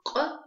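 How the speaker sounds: «к» из гортани, резко и высоко; резко разомкни связки на «к», без придыхания; гортанное к. Shown as a demonstration. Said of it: "это гортанный звук". (отрывистое гортанное «к»)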